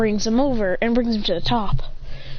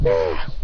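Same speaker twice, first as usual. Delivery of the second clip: short low acted growl; effortful grunt. A narrator's reading. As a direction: grunt out a low battle cry.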